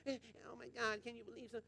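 imitating a whispering gossip; mock gossip whisper. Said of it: "All that whispering's from Satan".

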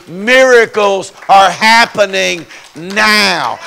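shouting preacher climax. Real, not acted. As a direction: let it ring out like a revival climax.

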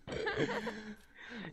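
laughing out the words. Real, not acted. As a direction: break into laughter. Laughing at death threat story.

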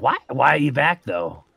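normal talking voice

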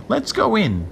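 high, squeaky little urging; tiny voice. A narrator's reading. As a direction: urge onward in a teeny shell voice. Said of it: "the tiny voice".

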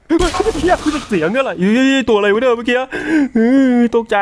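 startled. Real, not acted. Streamer jolted by a scary noise in the game, startled yelp. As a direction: jump at the sound and cry out.

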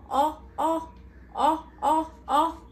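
short open oh; oh sound. Shown as a demonstration. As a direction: call out oh, oh. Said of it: "O makes the sound oh".

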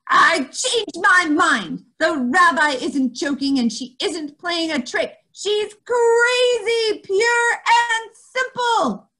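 loud, high, slow bellow; mother bellowing at her daughter, loud and slow. Acted. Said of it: "her mother bellowed".

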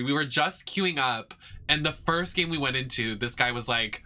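ordinary talk

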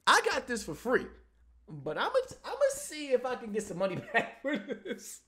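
high, giggly and gleeful; laughing. Real, not acted. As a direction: chuckle while you say it. Gleeful aside about free refund.

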